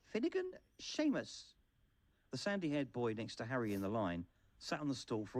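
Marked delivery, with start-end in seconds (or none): 0.1-1.6 high, slow calling out of a name; formal name call across a hall; roll call. A narrator's reading. Teacher calling the roll of names.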